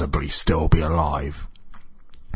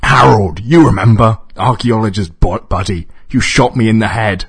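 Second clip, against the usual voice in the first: loud, high, indignant; exasperated shouting; 5 semitones higher, 8 dB louder than usual. A narrator's reading. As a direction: shout to jog his memory. Screaming villain explains who he is.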